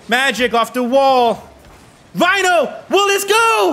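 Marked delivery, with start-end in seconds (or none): 2.1-3.7 high-pitched, suspenseful sportscaster call; excited, raised-pitch play-by-play; excited shot call. Real, not acted. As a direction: call the shot as it heads for goal. Live call of a shot on goal.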